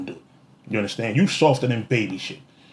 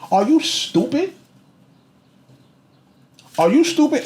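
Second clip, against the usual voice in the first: incredulous; 11 semitones higher than usual. Real, not acted. Vlogger mocking a rival, high incredulous disbelief. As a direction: ask it like you can't believe it.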